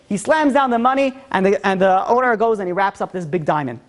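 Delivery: usual speaking voice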